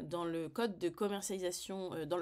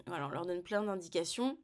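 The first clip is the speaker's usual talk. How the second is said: normal talking voice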